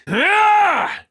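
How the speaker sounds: loud, very high attack scream; battle yell. Acted. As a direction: yell with full effort on the strike. Combat yell.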